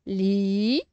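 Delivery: "Livre" split into li... vre, first part drawn out; syllable by syllable. Shown as a demonstration. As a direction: sound out "Livre" as two separate syllables. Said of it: "on sépare les syllabes".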